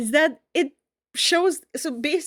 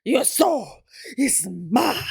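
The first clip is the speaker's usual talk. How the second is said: villain impression; slower than usual. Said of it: "I don't remember how he does it".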